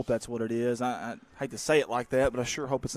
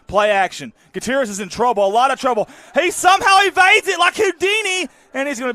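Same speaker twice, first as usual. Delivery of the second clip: rising, louder call, peaking high on the escape; excited play call; 12 semitones higher, 10 dB louder than usual. Real, not acted. Announcer calling the quarterback escaping trouble, climbing excitement. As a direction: call the scramble as it unfolds.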